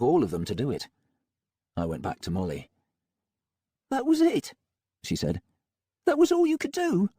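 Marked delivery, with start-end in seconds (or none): 3.9-4.5 higher, concerned woman; doubtful, uneasy question; Molly voice. A narrator's reading. Molly disappointed. 6.0-7.1 higher, concerned woman; doubtful, uneasy question; Molly voice. A narrator's reading. Molly reproachful.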